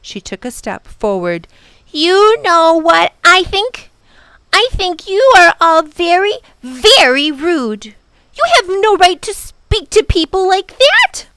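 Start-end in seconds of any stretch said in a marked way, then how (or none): 1.9-11.3 high, loud outburst; indignant little girl. A narrator's reading. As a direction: tell them off, high and loud. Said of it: "could not contain herself no longer".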